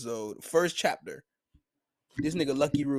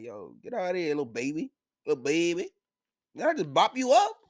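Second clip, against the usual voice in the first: rising, louder mock-baby taunt; 5 semitones higher, 6 dB louder than usual. Higuma sneering at Shanks as a baby, rising mocking taunt. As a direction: taunt him like a baby, getting higher.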